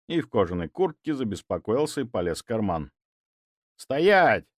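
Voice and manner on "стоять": loud sharp bark; barking command. A narrator's reading. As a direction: bark the order. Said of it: "рявкнул я". (резкий окрик)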